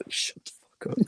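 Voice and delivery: hushed unvoiced whisper; breathy hushed aside. Real, not acted. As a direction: whisper it so nobody hears.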